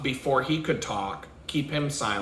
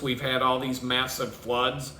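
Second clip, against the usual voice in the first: ordinary delivery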